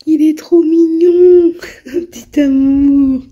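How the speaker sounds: high, loud, drawn-out gushing coo; cooing adoringly. Real, not acted. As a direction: coo over a tiny adorable bird. Attendrie devant un rouge-gorge. (roucoulement attendri, aigu et traînant)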